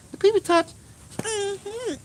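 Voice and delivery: very high squeaky silly chant. Acted. Goofing around for the room in a squeaky high chant. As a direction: squeal it like a cartoon child.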